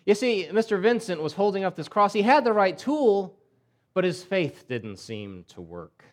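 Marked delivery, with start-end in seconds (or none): none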